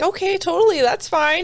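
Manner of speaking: fake fine. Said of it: "you and me would be like".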